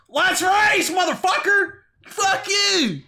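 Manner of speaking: hammed-up street racer shout; mock shout. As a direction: bellow a challenge like a meathead racer. Street Outlaws guys voiced.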